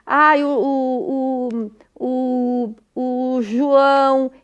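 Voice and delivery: imitating a child stuck on a word; imitação de disfluência. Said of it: "Por exemplo". (imitando criança gaguejando, arrastado)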